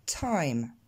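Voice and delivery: "Time" with a puff of air after the t; t aspiré. Shown as a demonstration. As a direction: model English "Time", exploded t. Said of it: "je vous invite à répéter derrière moi". (« Time » avec un t soufflé)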